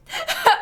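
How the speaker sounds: sudden squeaky laugh; high laugh. Real, not acted. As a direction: break into a high cackle. Laughs at own handling.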